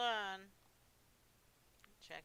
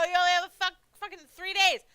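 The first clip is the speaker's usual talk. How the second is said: loud, high frustrated yell; loud, higher exasperated yell; 10 semitones higher, 17 dB louder, faster than usual. Real, not acted. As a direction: shout it at the screen. Streamer yells at screen.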